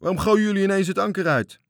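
high, sustained full-voiced yell; bellowing. A narrator's reading. Sailors demand answers from the little captain, bellowing. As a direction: roar the question across the water. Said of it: "brulden de matrozen".

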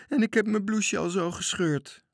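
high, whiny complaint; whining boy. Acted. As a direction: whimper like a tired child. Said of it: "bleef jammerend staan". (bange Toontje jammert om zijn gescheurde bloesje)

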